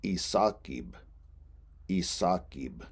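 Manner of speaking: isakib said carefully, syllable by syllable; Lakota isakib. Shown as a demonstration. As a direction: model the word isakib for students. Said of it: "Third word".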